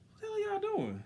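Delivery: high, incredulous shout-question out the window; exasperated call. Retold or imitated. Waiting driver yelling at the restaurant staff, exasperated. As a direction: demand it like you've waited forever. Impatient man calling out window.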